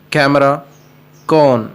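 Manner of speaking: normal talking voice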